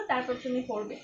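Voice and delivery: ordinary talk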